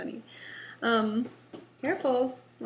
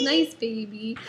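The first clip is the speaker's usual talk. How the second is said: a hint of a toddler cooing; a lightly voiced toddler cooing to her doll; 5 dB louder, slower than usual. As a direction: gently play the toddler soothing her doll. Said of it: "rubs their head and says".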